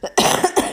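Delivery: hacking cough mid-rant; loud, unvoiced coughing fit; real cough. Real, not acted. Interrupted while ranting about Louis, real coughing. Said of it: "this cough is so horrible".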